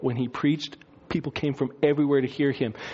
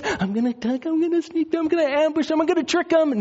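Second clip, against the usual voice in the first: high, mischievous plotting voice; sneaky Jesus; 12 semitones higher than usual. Pretend. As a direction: scheme it like a playful prankster, pitched well up. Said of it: "pretending not to be who he really is".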